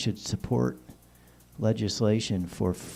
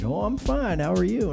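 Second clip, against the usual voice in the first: high surgeon voice; 9 semitones higher than usual. Retold or imitated. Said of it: "And he was like".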